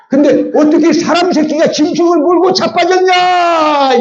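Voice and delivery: mother's furious shout. Retold or imitated. Mother shouting at her son for biting the cow, furious high yell. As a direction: yell it as the outraged mother, half laughing.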